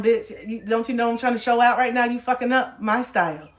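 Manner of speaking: ordinary talk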